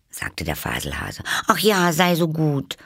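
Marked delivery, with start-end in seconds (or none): 1.5-2.8 high, louder eager plea; pleading. A narrator's reading. Alice begs the Dormouse for a story, pleading. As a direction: beg on a raised pitch. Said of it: "bat Alice".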